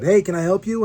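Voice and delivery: high, loud call across the park; retold owner calling out loud and high. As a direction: call out to a stranger on your land. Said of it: "some guy yells out".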